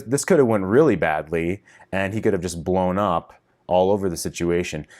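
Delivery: usual speaking voice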